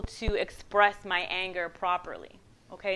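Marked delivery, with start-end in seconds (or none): none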